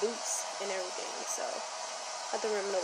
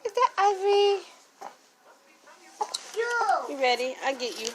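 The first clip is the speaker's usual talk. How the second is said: sing-song mom teasing her infant; 12 semitones higher, 4 dB louder than usual. Real, not acted. Mother cooing to her baby.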